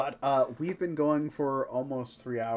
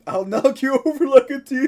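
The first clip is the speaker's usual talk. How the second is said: high, loud laughing mock threat; mock yell; 13 semitones higher, 14 dB louder than usual. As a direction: shout it through laughter. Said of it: "they said".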